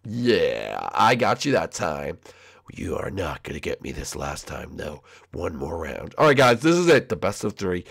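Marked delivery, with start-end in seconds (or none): 0.1-6.1 low, soft threat; deep and quiet, sure of himself; low rival's warning; brooding Shadow. Acted. Shadow warns Junior.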